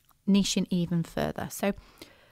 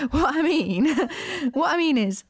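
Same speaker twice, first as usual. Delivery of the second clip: laughing; 8 semitones higher than usual. Real, not acted. Laughing after bad language joke.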